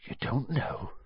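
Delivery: hushed, soft realisation; soft, falling murmur of disbelief; stunned disbelief. A narrator's reading. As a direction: say it quietly, taken aback. Hagrid stunned, repeats himself.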